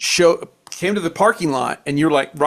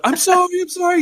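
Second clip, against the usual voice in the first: much higher, squeaky scared repeated apology; panicked apology. Retold or imitated. Night desk guy begging his furious boss, high panicked apology. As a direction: yelp it out like a cornered kid.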